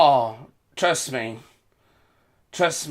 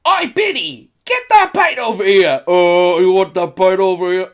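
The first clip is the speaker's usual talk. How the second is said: hammed-up London pub yell; mock British pub shout; 3 semitones higher, 10 dB louder than usual. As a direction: bellow for a pint like a rowdy local. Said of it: "like, you know".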